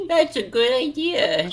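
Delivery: high creaky old man imitation. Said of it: "What a great imitation of Old Man Elmer's voice".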